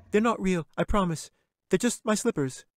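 put-on high little girl, pleading; a small girl nervously promising. Katy pleads with the bear.

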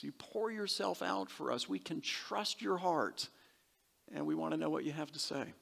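soft, reverent praying voice; hushed prayer. Acted. Preacher turns to address the Lord, hushed and prayerful. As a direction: pray it gently, dropping well under preaching volume.

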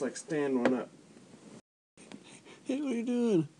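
normal talking voice

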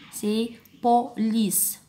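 very slow, police split into beats; syllable by syllable. Shown as a demonstration. Teacher slowing police down for counting. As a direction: stretch po-li-ce so each beat can be counted. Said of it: "compter les syllabes".

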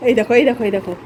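ordinary talk

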